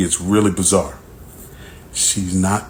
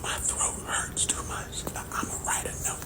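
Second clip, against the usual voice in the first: voiceless, much softer whisper; 18 dB quieter than usual. Retold or imitated. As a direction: whisper like a sore throat stops you talking. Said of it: "So then she write notes".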